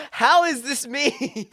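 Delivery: loud, high, drawn-out disbelief with laughter; baffled, laughing cry of protest; incredulous outcry. Retold or imitated. Retelling baffled reaction to comparison.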